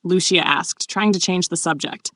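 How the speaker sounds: ordinary delivery, nothing marked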